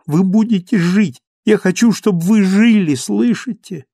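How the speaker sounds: high, loud, desperate command; crying out. A narrator's reading. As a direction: plead at full pitch, insisting. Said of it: "кричала она".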